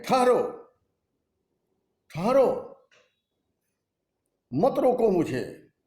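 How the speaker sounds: high, forceful cry to halt; commanding interruption. Acted. Kaikeyi stopping the protesting royal assembly, commanding interruption. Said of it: "उनको रोकते हुए कहती है".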